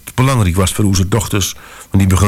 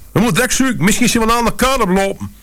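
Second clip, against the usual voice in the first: panicked lady; faster than usual. Acted.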